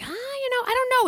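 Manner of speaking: high-pitched hesitant lady voice; high voice imitating bartender. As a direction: mimic her unsure reply up high. Bartender's unsure reply quoted.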